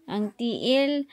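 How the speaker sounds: normal talking voice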